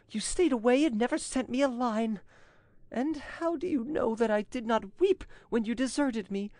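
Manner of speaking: high, theatrical, imploring female voice; pleading Angelique. A narrator's reading. Angelique begs her lover to drop the duel, desperate. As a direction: beseech at a high pitch, sustained and desperate.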